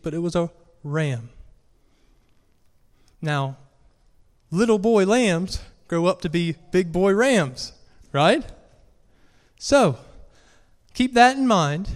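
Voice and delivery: ordinary talk, nothing marked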